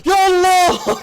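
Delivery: high and loud yelp of surprise; surprised cry. Real, not acted. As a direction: burst out in disbelief.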